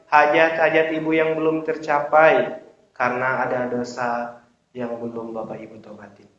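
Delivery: ordinary talk, nothing marked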